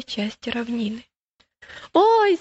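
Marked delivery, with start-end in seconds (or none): 1.9-2.4 high, loud yelp of alarm; startled cry. A narrator's reading. Boy falling into the bog, crying out in alarm. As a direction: cry out as the ground gives way. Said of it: "закричал он".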